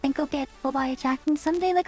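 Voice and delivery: normal talking voice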